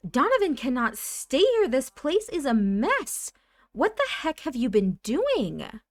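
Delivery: high, loud mock scolding; angry ordering. Nancy ordering her ex to clean up, angry. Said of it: "She's gonna order him to clean".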